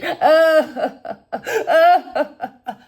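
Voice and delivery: high, loud scornful laughter; mocking laugh. Real, not acted. Laughs at her crying.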